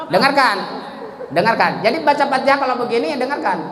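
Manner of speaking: usual speaking voice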